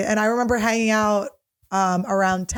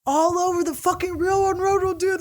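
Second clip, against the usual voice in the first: put-on excited drunk friend; 6 semitones higher than usual. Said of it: "he's just going".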